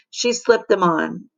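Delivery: ordinary delivery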